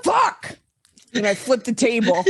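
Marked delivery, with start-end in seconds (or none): 0.0-0.6 high, sudden cry of frustration; acted cry of fury; exasperated outburst. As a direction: blurt it out at the end of your rope. Character snaps at the fire alarm.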